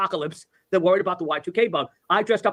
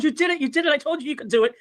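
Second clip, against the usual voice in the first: high-pitched, delighted congratulations; higher-pitched gushing mock-mom; excited mother; 8 semitones higher than usual. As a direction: imitate a proud mother cheering, voice lifted high. Mother cheering her son's win.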